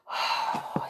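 long, breathy sigh; weary sigh. A narrator's reading. Mister Happy despairs over Mister Tickle, sighing. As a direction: let out an acted sigh. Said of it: "He sighed".

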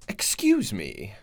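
a slow, lightly put-on British "Excuse me"; polite British stranger, said slowly and low; bad British accent. British guy interrupting the women at lunch, badly faked British accent. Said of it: "that was a shitty British accent".

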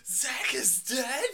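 loud, slow, laughing fake surprise; mock shocked. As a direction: ham up the shocked innocent act. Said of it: "You're supposed to be like".

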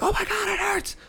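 very high squeal of pain. Retold or imitated. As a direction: shriek it like a scared kid. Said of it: "I'm like".

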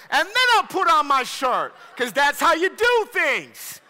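loud, high-pitched mock outrage; indignant shouting, played for laughs; comic angry rant. Preacher ranting to the congregation about dressing a baby, mock-angry. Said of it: "working through my anger issues".

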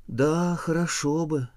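slow, drawn-out wishing, voice lifted; wistful sigh. A narrator's reading. Wife to husband about a cow, wistful sighing. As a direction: sigh it out slowly, longing for it. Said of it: "вздыхает жена".

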